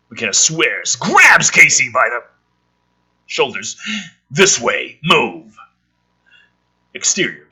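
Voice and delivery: reading a screenplay aloud, a touch dramatic; lightly dramatic screenplay read; script reading. Acted. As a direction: read the scene headings and action like a narrator.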